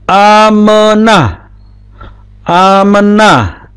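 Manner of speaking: amenah slow and stretched, a-me-nah; voiced sheva. Shown as a demonstration. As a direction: draw out amenah with the middle e sounded.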